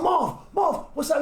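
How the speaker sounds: high retold shout of a name; mock startled yell across the street; surprised shout. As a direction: call out the name in shock. Spotting a friend back from dead.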